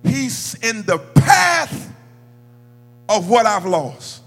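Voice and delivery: loud, high preacher's delivery; building sermon climax with long pauses; full-force preaching. Real, not acted.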